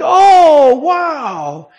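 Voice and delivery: loud high swooping gasp of wonder, falling away; retold amazement. Couple seeing the card together, imitated loud amazement. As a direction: imitate being bowled over.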